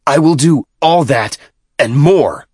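forceful defiant vow; defiant threat. A narrator's reading. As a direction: hurl it back as a threat. Vice minister threatens in quarrel.

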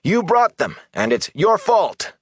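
high-pitched shout of blame; accusing shouts. A narrator's reading. Crowd blaming the Aes Sedai, accusing shouts. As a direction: shout it like one of an angry crowd. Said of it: "and there were shouts of".

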